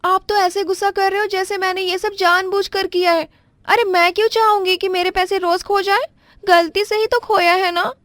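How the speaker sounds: pitched well up, flustered daughter-in-law apologizing; young daughter-in-law. Acted. Wife protests.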